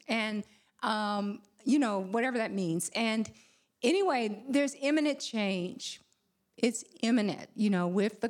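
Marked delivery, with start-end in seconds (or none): none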